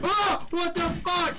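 high, loud startled shout; startled scream. Real, not acted. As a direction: jolt in shock. Jump scare.

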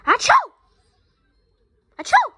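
loud high fake sneeze; hammed-up sneeze with a spray. Fake sneezing on his sister as a prank. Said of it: "a fake sneeze on your sister".

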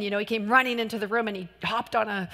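usual speaking voice

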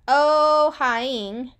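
ordinary talk, nothing marked

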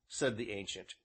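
ordinary delivery

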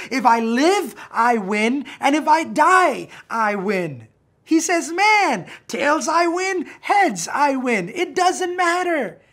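loud, high and exultant; triumphant shout. Acted. Preacher as Paul, shouting his joy at death. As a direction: proclaim it like you've already won.

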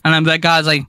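usual speaking voice

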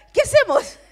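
high, loud startled cry; a shocked what-do-we-do yelp; startled panic. Retold or imitated. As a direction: blurt it out in sudden alarm. Caught off guard at the door.

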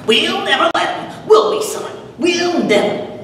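put-on high, sly old witch teasing her son. The witch assures her son the bones stay put, high and sly. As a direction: tease the son in a high crone voice.

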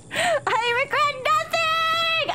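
very high, slow, drawn-out gloat; 1 dramatic gloat. Real, not acted. Gamer gloating after wrecking the town, slow and high. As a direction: savor each word like a villain.

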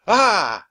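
loud high startled "Ah!"; startled yell. Acted. The kids startled by monkeys, loud yell. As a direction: jump back with a yelp of fright. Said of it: "Jack and Annie yelled".

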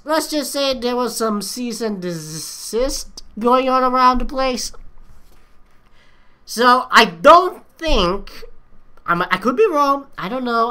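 ordinary talk, nothing marked

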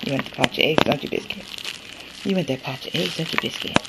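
soft, half-whispered tease to the dog; hushed teasing. Real, not acted. Holding the egg pouch, softly teasing her dog Biscuit. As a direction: tease him gently under your breath.

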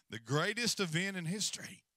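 normal talking voice

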